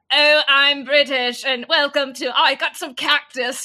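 hammed-up British accent, big and goofy. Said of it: "goofy British voice".

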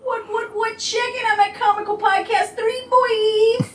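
put-on tough guy. Host butting into the social plug in a silly tough-guy voice. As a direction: ham up a swaggering "boy".

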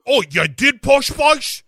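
put-on high shouting cockney girl; mock cockney. Acting Spice Girls banter.

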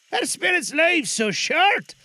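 put-on high, slow old-man plea; Scrooge voice. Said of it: "asked Scrooge".

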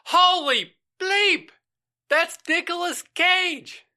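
high, loud, drawn-out exclamation; hammed-up shock at spotting a celebrity; starstruck amazement. Acted fast food worker spots star.

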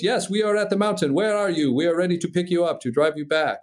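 put-on French accent over the radio; French instructor accent. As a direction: imitate a French instructor, brisk and assured. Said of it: "And he goes".

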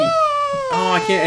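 overjoyed. Acted. Retelling the teacher's baby news, overjoyed squeal. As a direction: overcome with pretend delight. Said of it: "Oh, she's so happy".

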